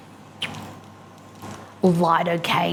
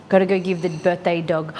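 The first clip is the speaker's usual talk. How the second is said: normal talking voice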